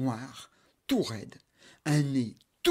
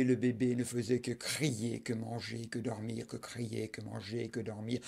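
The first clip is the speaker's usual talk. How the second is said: softer, tired repetition; weary fading; 3 semitones lower, 7 dB quieter than usual. A narrator's reading. Narrator droning the baby's routine, tired and soft. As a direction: let the days grind her down.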